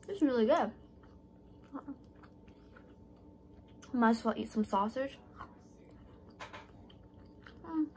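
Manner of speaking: chewing while talking; mouth full of rice. Real, not acted. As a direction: lips barely parting. Seen 1: Rice bite at -1.0; closed, pursed lips chewing through the words.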